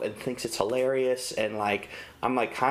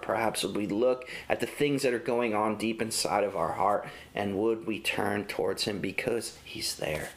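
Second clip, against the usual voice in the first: ordinary talk, nothing marked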